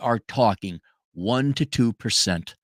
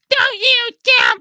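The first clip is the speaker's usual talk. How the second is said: very high, loud, slow shriek; mocking the outrage at raising your own kids; mock outraged shriek; 7 dB louder, slower than usual. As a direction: shriek it like someone scandalized.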